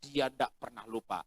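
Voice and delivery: normal talking voice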